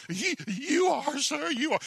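frightened squeak. Acted. Antelope cowers before the lion, frightened squeak. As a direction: stammer it out in terror. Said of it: "the antelope goes".

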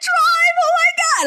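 high, loud panicked cry to drive; panicked plea. Retold or imitated. Begging Josh to drive away, panicked high cry. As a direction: scream at him to get going. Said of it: "And I was like".

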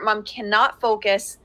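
normal talking voice

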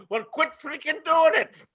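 a loud, high yelled heckle from the crowd; heckler's yell. Retold or imitated. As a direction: shout it back from the audience. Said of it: "audience yelled out".

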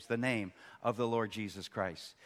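usual speaking voice

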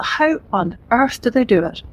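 usual speaking voice

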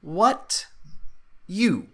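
high-pitched kobold struggling with the language; broken Common. Acted. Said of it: "in Common goes".

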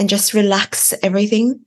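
normal talking voice

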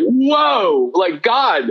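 high, stunned whoa falling away; overwhelmed whoa. Retold or imitated. Man retelling God's glory in his bedroom, stunned whoa. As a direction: gasp it out in wonder. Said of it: "I was like".

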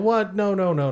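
high, startled protest; shocked disbelief. Acted. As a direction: yelp it out in disbelief. Drunk guy denies being drunk.

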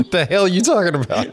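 laughing while asking; words bubbling with laughter. Real, not acted. Laughing through the question.

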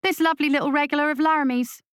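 high, fast cackling taunt; cackling witch. Acted. Witch taunting the captain about her hostage, high and cackling. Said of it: "she said with a cackle".

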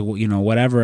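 normal talking voice

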